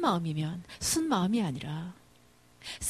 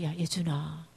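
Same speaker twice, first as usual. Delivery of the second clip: softer, lower cautious address; careful, gentle; 5 semitones lower, 5 dB quieter, slower than usual. Acted. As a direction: gently get your son's attention. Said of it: "조심스럽게". (조심스럽게 낮은 목소리로)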